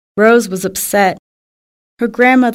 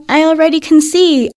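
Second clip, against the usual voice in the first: excited; 6 semitones higher, 4 dB louder than usual. Acted. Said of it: "Oh, Grandma".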